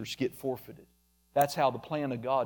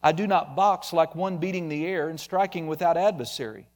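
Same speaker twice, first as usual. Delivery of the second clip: normal talking voice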